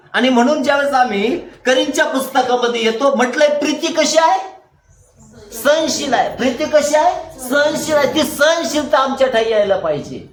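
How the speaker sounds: high, ringing question-and-answer sermon; fervent preaching. Real, not acted. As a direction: put the question to the congregation and answer it emphatically.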